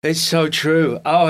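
normal talking voice

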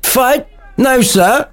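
very high put-on character voice; high mock-incredulous reply; Montague servant. Montague enemy answering Gregory's challenge, high put-on voice. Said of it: "getting these accents round the right way".

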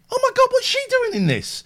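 very high shocked squeal; shocked surprise. Retold or imitated. Spotting a mod in Home Alone, high shocked squeal. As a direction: gasp it out way up high. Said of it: "I watched it and went".

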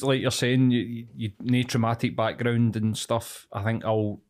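ordinary talk, nothing marked

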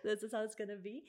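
embarrassed laugh, quick words; laughing at herself. Real, not acted. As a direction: laugh it off, rushing a little. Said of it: "I apologize for my French accent".

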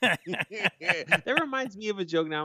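laughing through the words, slowly. Real, not acted. Cohost still laughing at the bridge joke, real laughter. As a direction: still cracking up while talking.

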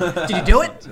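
loud, laughing question. Acted. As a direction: blurt it out with a laugh. Jokey mock interrogation.

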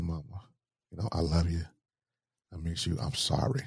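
soft, hushed bedside words; quiet, understated confession of love; retold tender apology. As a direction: speak it softly into her ear. Retold bedside words to dying mother.

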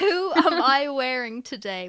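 slow, loud red carpet preening; gushing red carpet chatter. Pretend. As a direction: savour it like a star on the carpet. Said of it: "who are you wearing right now".

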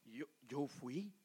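soft, slow humble confession; admitting fault to a brother, soft and slow; humble apology. Acted. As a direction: own up to it quietly and slowly. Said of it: "¿qué tiene que hacer uno?".

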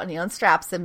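ordinary talk

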